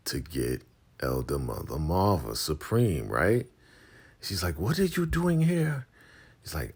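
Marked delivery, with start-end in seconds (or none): 4.6-5.9 very high surprised question; imitating a woman caught off guard, very high; mock surprise. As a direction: squeak it out in shock.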